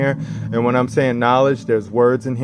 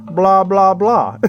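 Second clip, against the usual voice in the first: animal noises; 7 semitones higher, 4 dB louder, much slower than usual. Retold or imitated. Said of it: "They make noises".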